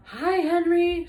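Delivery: high, loud calling out; girl shouting hello to the neighbor's dog, high and loud; shouted greeting. A narrator's reading. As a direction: call out across the yard to the dog. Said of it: "shouted Annie".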